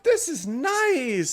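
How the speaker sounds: high, slow, drawn-out delight; very high-pitched. Acted. Excited woman in a three-way hug, high and drawn out. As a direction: stretch it out in a squeaky voice. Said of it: "in a very high-pitched voice".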